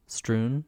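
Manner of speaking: "Strewn" with an oo vowel, questioning; questioning pronunciation. Shown as a demonstration. Checking a word with co-host, questioning "Strewn" with oo. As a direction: try out "Strewn" to rhyme with "moon".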